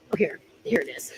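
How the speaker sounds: ordinary talk, nothing marked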